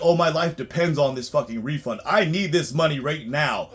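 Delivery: mocking a broke client. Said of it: "they're like".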